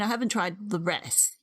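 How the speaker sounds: normal talking voice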